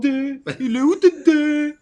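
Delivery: plus aigu, fort et lent, façon vieille pub télé; imitation de pub. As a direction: crie le slogan comme dans la réclame d'époque. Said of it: "tu te rappelles la pub à l'époque?". (rejouer la vieille pub qui le taquinait, voix de réclame criée)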